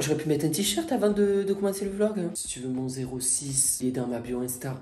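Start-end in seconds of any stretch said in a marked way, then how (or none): 2.3-4.7 low, much softer come-on voice; mock seductive. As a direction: tease like a fake flirt. Non, je déconne.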